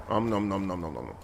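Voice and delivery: fast, playful munching noises; nom nom chomping. Pretend. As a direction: chomp it like a hungry cartoon. Pretend eating elf roots.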